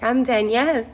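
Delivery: Danielle with the A turned to E; altered name. Retold or imitated. Giving the stranger a false name, 'Danielle' stressed on its E. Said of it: "popped in the A to a E".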